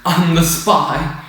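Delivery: mock Hux. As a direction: drag out the reveal like a lame twist. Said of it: "Hux is like".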